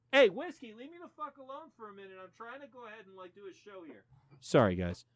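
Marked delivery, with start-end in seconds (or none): 0.1-4.0 quick aside, voice dropping far from the mic; mock telling-off away from mic. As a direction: turn away and scold someone across the room. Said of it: "Had to have a talk with the Whiskey".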